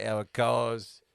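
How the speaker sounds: imitating Jeremy Clarkson. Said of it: "Jeremy Clarkson's like".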